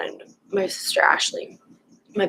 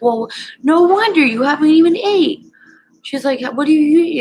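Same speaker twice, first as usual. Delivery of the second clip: higher, louder exasperated grandma voice; grandma telling her off for not eating; scolding grandma; 9 semitones higher, 5 dB louder than usual. Retold or imitated.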